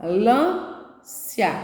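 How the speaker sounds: AMBULÂNCIA in four slow pieces, pausing between each; syllable by syllable. Shown as a demonstration. Teacher counts syllables for pupils, slow separated demonstration. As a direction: break Ambulância into am, bu, lân, cia. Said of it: "Você vai falando e cada sílaba".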